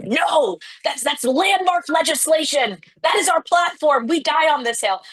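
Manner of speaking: high-pitched, emphatic imitation of outrage; mock indignant refusal. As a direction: act out a party digging in, pitched well up. Retelling party's indignant refusal to tweak.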